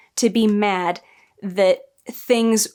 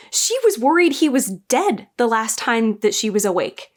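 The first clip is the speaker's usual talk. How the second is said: ordinary delivery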